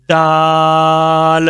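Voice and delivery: دال held very long; very slow دال; drawn-out daal. Shown as a demonstration. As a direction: draw دال out slowly. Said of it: "بہت easy".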